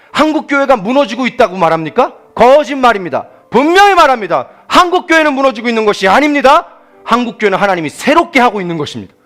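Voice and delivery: loud, high declaration; fiery preaching. Acted. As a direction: proclaim it with full force. Preaching at full force.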